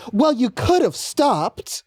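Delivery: mock indignant, high and drawn out; indignant older lady. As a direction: imitate a huffy lady's high, slow reproach.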